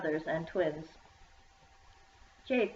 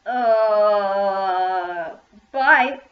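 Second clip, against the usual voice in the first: cried out; 10 dB louder than usual. A narrator's reading. Shy boy fleeing the girls, blurting a loud goodbye. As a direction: blurt it and bolt. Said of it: "cried Jack and ran away".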